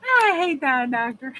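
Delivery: laughing. Real, not acted. Laughing after mocking the doctor.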